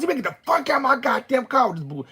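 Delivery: loud, high furious order; screaming. Retold or imitated. Woman kicking her ex out of her car, screaming. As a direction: throw them out at the top of your voice. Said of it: "screaming at you and scaring you".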